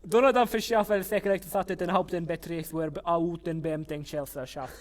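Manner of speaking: rapid-fire German compound in one run. Shown as a demonstration. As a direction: rattle the long German word off. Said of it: "Say the word again, but faster".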